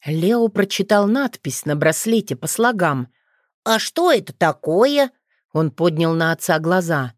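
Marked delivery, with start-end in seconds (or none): 3.6-5.1 put-on lightly higher child's voice; a curious little boy asking; hint of a child's voice; small boy. Boy Leo asks his father.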